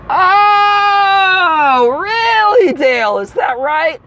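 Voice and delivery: very high, drawn-out "oh, really"; mock outrage. Passenger confronting the attendant who won't give information, mock outrage. Acted outburst at attendant Dale.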